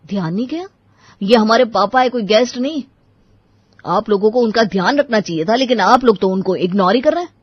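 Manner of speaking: loud, indignant scolding; angry reproach. Acted. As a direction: build from disbelief into loud accusation. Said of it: "Ram को बहुत गुस्सा आया".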